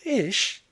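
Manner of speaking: short high "ish" like a sneeze; mock-sneeze scoff; disgusted exclamation. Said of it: "you're sneezing already".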